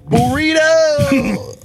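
slow, high, stretched burrito; mock dramatic drawn-out burrito. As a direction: drag the word out for the joke. Drawn-out punchline word.

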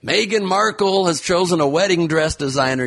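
high, gushing gossip voice; mock excited gossip. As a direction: gush like a tabloid host.

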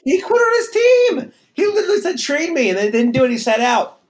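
high, loud, protesting shout; loud heated rant; indignant outburst. Real, not acted. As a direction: argue it at full pitch, outraged. Heated outburst defending his trade stance.

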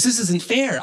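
high-pitched mock complaint; aggrieved storytelling voice; acted protest. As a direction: object like someone unfairly trapped. Acted protest of the rope holder.

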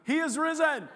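loud joyful Easter call; rousing preacher's shout. Shown as a demonstration. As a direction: call it out to the whole church. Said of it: "Okay, ready?".

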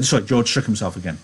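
ordinary delivery, nothing marked

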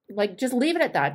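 normal talking voice

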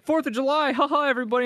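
high, bright cartoon host cheer; mock cheery ad voice. Friends mocking an anime anthem ad, high cheery voice. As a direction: ham up a chipper holiday ad.

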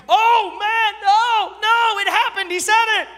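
high, loud, hammed-up dismay; mock horrified leaders. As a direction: imitate scandalized leaders wailing in shock. Acting out horrified church leaders.

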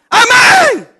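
high, loud amen hurled at her; defiant amen shout. Retold or imitated. Teasing the angry church sister: loud high amen. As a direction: shout amen right back at the annoyed sister. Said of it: "Y cuando yo la miraba".

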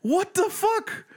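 high-pitched, incredulous cursing, retold; outraged disbelief. Driver retelling finding both headlights on, mock outrage. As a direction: re-enact outrage, pitch leaping up.

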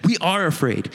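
usual speaking voice

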